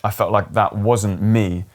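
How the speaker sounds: ordinary delivery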